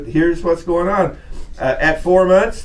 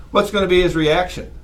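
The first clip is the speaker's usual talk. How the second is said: ordinary talk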